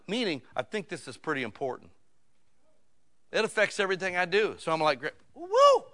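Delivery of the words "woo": high, loud "woo!"; startled whoop. Retold or imitated. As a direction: yelp as if jolted by a shock. Acts out being shocked.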